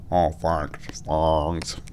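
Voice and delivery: put-on low, nasal French accent. Vlogger showing off French to self, nasal French accent. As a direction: put on French through the nose. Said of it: "go out your nose".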